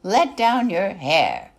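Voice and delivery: loud, slow call up to the tower; yell. Acted. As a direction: yell it up to the window, drawn out. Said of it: "she would yell".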